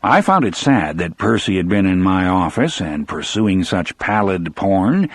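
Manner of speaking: normal talking voice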